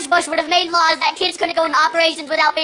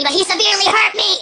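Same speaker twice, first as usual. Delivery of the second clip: ordinary talk, nothing marked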